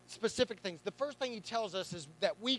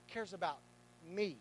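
"cares about me" softer, leaning on "me"; personal, soft; 4 semitones lower, 9 dB quieter than usual. Shown as a demonstration. Pastor retrying the line to the congregation, soft and personal. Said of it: "let's do it like this".

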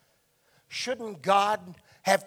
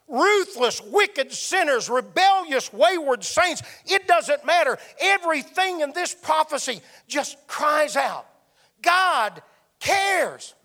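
full-force preaching; 4 semitones higher than usual. Acted. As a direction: preach it out, building to the peak. Preacher builds to climax.

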